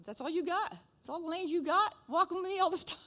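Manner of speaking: high, teasing voice of the Lord; mock-incredulous divine voice. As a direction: play the Lord gently poking fun. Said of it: "The Lord says".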